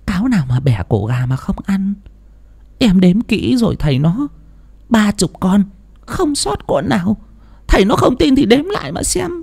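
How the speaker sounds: high wife voice, objecting firmly. A narrator's reading. Wife objecting to husband's fox theory, high and insistent. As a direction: push back on his guess, in a high woman's voice. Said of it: "Bà Thủy phản đối".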